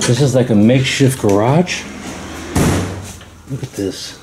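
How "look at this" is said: ordinary talk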